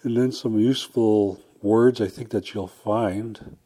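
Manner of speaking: ordinary talk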